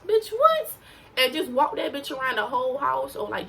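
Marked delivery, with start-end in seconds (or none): 0.1-0.7 high, loud disbelieving yelp; mock shock. Telling viewers her cinnamon trick, in mock shock. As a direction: squeal it like you can't believe it.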